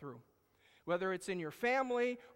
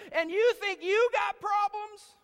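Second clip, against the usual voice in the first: much higher, louder, slow disbelief; incredulous challenge; 12 semitones higher, 9 dB louder than usual. Real, not acted. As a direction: throw it at them, loud and high. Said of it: "preacher challenges congregation".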